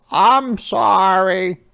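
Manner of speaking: high, loud, slow truck character voice; a big friendly truck, sorry and apologetic; Yellow Truck's voice. Acted. Yellow Truck apologises to Little Red in a high, loud voice. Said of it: "said Yellow Truck".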